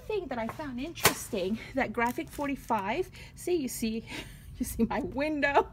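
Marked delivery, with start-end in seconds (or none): none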